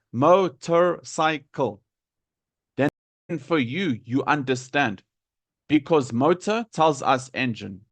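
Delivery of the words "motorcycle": mo-tor-cy-cle, slow and separated; every syllable of motorcycle stressed. Shown as a demonstration. Teacher helping student understand, every syllable of motorcycle stressed. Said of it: "put emphasis on every single syllable".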